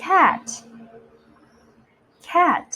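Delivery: "cat" high with a crisp final t; slow, clear model. Shown as a demonstration. Teacher models "cat" for children to repeat, slow and clear. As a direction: model "cat" as one careful, separate word. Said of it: "อ่านตามพี่ช้าๆช้าๆชัดๆ".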